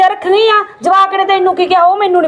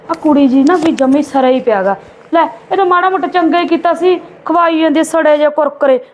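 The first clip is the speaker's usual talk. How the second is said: ordinary delivery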